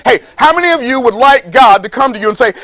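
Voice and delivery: ordinary delivery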